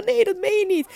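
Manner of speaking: high, disbelieving mock reaction; shocked listener. Listeners hearing the birth story, imitated high shocked disbelief. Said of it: "iedereen kijkt dan echt zo van".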